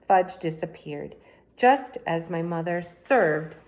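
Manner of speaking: ordinary delivery, nothing marked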